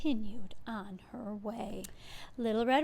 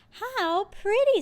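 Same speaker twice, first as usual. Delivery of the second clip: high, slow and louder delight; delighted girl; 10 semitones higher, 7 dB louder, slower than usual. A narrator's reading. Girl admiring flowers in the clearing, high and delighted. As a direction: sigh it like a charmed little girl.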